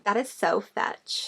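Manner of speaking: drawn-out slow quote; Mean Girls quote. Retold or imitated. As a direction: say it like a queen bee. Quoting Mean Girls catchphrase.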